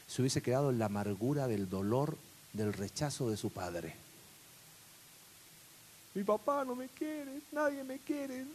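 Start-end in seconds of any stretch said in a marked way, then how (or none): 6.1-8.4 put-on a higher, slow, wounded young man's plea; hurt young David. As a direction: voice David's hurt at his father. David voiced lamenting rejection.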